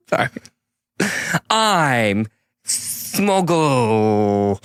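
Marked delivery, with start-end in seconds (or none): 1.5-4.6 very slow, solemn hero proclamation; grand hero declaration. Acted. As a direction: proclaim it like a final boss fight. Heroic declaration.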